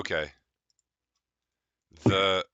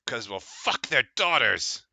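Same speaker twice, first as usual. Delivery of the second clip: crude boast; 5 semitones higher, 5 dB louder, slower than usual. Acted. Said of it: "says one of the other ones".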